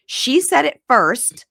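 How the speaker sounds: SHE punched high and loud, drawn out; slow, loud insistence from SHE through first; emphatic insistence. Real, not acted. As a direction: hit SHE hard and stretch the words. Arguing who said it first.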